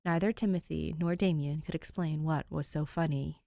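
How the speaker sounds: usual speaking voice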